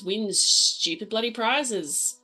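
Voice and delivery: ordinary talk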